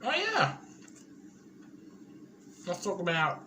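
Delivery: eating while talking; mouth full. Real, not acted. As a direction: talk through a mouthful. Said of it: "I'm just eating".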